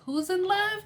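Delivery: high, startled voicing of Teresa; a light imitation of Teresa's surprise; surprised question. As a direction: pop up high in mock surprise. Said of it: "and Teresa's like".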